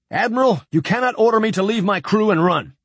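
a captain refusing to abandon his crew, heated; angry protest. A narrator's reading. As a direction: push back at the admiral, stung. Captain defies the admiral.

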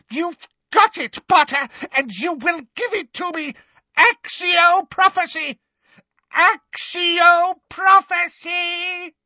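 very high, loud frantic shriek; terrified anger. A narrator's reading. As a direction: scream the demand in desperation. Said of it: "terror behind the anger now".

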